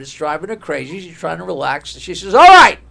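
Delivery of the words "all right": loud, high-pitched fed-up cry; exasperated outburst. Acted. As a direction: burst out to silence the chatter. Exasperated woman in joke.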